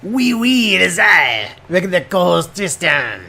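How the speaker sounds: hammed-up outrageous French accent; co-host echoing the intro in a mock French accent; fake French accent. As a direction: ham up a jokey French accent. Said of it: "That is a good accent".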